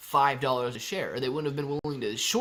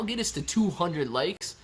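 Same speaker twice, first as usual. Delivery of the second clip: ordinary talk, nothing marked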